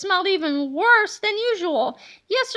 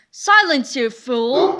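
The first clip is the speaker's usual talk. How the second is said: loud snap at the teacher; villain's shout; 3 semitones lower, 6 dB louder, slower than usual. A narrator's reading. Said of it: "Mister Meaner shouted".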